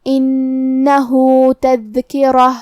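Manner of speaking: "انہو تذکرہ" slowly, with the هو of "انہو" held for two counts; "انہو" with a doubled nun and a long "hoo"; lengthened hu. Shown as a demonstration. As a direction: stretch the final vowel of "انہو" for two beats. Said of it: "ہم اپنی آواز دو مد کے برابر لمبی کریں گے".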